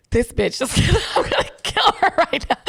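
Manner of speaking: mock murderous, laughing; half-joking threat while laughing; laughing through it. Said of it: "only because I'm like".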